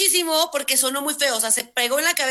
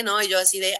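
usual speaking voice

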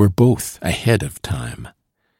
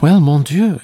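high, louder outburst; exclamation; 7 semitones higher, 5 dB louder than usual. A narrator's reading. Solange answering doubts about meeting in the street, exclaiming. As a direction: exclaim it with a pitched-up start. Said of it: "she exclaimed".

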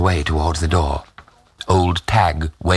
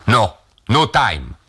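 slow, higher-pitched, lightly voiced soldier; hussar refusing; 4 semitones higher, slower than usual. A narrator's reading. Hussar waves away old Tag's drink, slow refusal. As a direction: decline slowly, lightly in character.

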